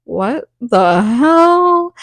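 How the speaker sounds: slow, stretched-out, incredulous reaction; lightly hammed-up disbelief; mock disbelief. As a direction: draw it out like a baffled classmate. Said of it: "were like".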